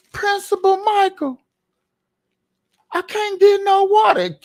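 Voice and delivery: put-on high, pleading kid voice; student whining to the principal about water, high and pleading; whiny student voice. Voicing a complaining student.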